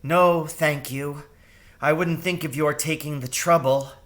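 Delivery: mother mouse hiding her terror politely; polite, hiding fear. A narrator's reading. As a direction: keep it polite while scared. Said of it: "trying hard to hide her terror".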